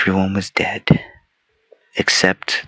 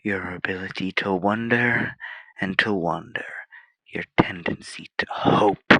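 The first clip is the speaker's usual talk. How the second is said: much softer, trailing into breath; hushed bitterness; 10 dB quieter than usual. Acted. Machine envying human wonder and hope, hushed. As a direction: drop to a very soft, brooding near-whisper. Brooding on human gifts it lacks.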